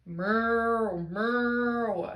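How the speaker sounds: drawn-out cow moo. A narrator's reading. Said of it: "said the spotted cow".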